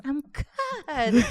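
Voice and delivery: usual speaking voice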